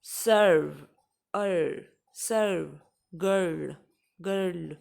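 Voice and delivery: सर्व and गर्ल with a long 'ar' sound; careful word-by-word demo of सर्व and गर्ल. Shown as a demonstration. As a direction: draw out the vowel and r in सर्व, गर्ल. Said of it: "अर अगर कहीं पे pronounce हो रहा है".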